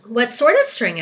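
loud, sharp acted question. A narrator's reading. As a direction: ask it pointedly, wanting an answer. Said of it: "asked the old green grasshopper sharply".

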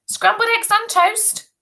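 very high, chirpy question; mum's high-pitched coaxing; coaxing mother. A narrator's reading. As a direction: sing out the offer in a high voice. Mother tries another offer.

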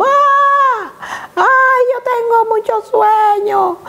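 put-on high, slow whiny little girl voice; sleepy crying child. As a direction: whine like a child woken too early. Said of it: "eso era llorando".

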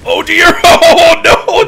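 high, loud laughing cry; real high-pitched yelp with laughter; startled dismay. Real, not acted. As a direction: cry out in amused alarm. Startled by danger in game.

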